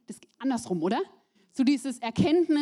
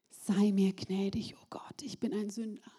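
whispered prayer; 3 semitones lower, 5 dB quieter than usual. Acted. Tax collector's quiet prayer.

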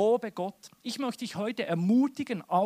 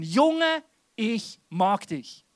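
exuberant praise; 2 semitones higher, 7 dB louder, much slower than usual. Acted. Preacher cheering God on like a buddy, exuberant praise. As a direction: cheer it out heartily, stretching the words.

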